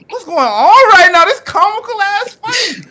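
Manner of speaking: high, loud, amazed yelp at the chaos; excited disbelief. Retold or imitated. Kid watching his mom's store fight, shocked yelp. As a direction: cry out in shocked amusement. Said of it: "I'm like".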